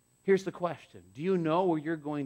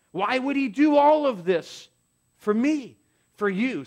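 slow, louder, higher appeal; preaching, slow and swelling; 7 semitones higher, 6 dB louder, slower than usual. Acted. Pastor asking the congregation why Christ died, slow swell. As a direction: lean into each word, pleading.

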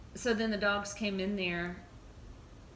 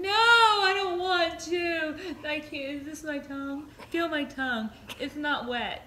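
put-on woozy drugged teen; 8 semitones higher, 7 dB louder than usual. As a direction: drag out the dopey protest. Mom voicing drugged daughter's refusal.

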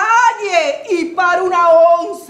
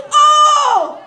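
a loud, high whoop; high shouted oh; 8 semitones higher, 4 dB louder than usual. Acted. Storyteller whooping at the crowd, loud high shout. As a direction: belt out the oh. Refrain exclamation to audience.

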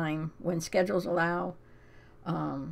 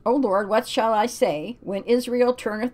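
scripture reading; 4 semitones higher, 5 dB louder than usual. Acted. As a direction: read it like a solemn verse. Reads Bible passage aloud.

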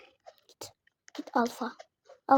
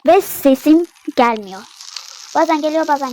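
loud; 13 dB louder than usual. Real, not acted. Internet kötü, bağırarak anlatıyor.

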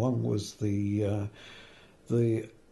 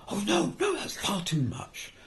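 the boss, hand over the phone, balking at twenty-five pounds in a much higher voice; mock shocked refusal; 11 semitones higher than usual. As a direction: voice leaps far higher in imitated horror.

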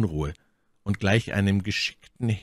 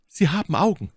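high, emphatic reproachful cry; anklagender Ausruf; 11 semitones higher, 4 dB louder than usual. A narrator's reading. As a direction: call out the reproach with raised pitch. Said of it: "rief My Lady". (vorwurfsvoll gerufen, hoch)

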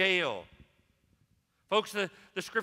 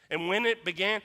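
ordinary delivery

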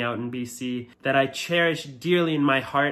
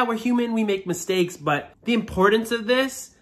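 usual speaking voice

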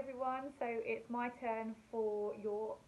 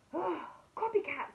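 higher, louder exasperated sigh of a complaint; fed-up dog; 6 semitones higher, 6 dB louder than usual. Acted. Dog complaining to cat who copies everything, mock exasperated. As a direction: play the dog's storybook frustration.

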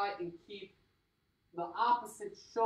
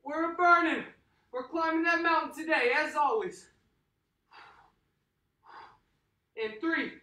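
high, loud pumped-up coaching; hype coach; 7 semitones higher, 7 dB louder than usual. Acted. Workout coach firing up the class, high and loud. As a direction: fire up the class like a trainer.

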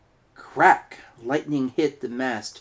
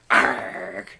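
pirate growl; 2 semitones lower than usual. Acted.

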